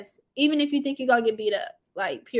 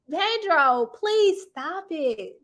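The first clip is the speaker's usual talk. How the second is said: drawn-out plea; 4 semitones higher, much slower than usual. Real, not acted. Exasperated plea to Pedro.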